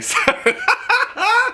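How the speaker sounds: a high squealing burst of laughter. Real, not acted. Laughing at going home.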